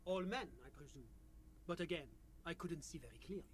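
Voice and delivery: put-on French-accented official; measured, polite French accent. GM voices official NPC.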